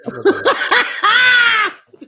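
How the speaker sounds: high, slow laughing shout of a punchline. Real, not acted. Host laughing at runners escaping police, shouting through laughter. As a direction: crack up while shouting the punchline out.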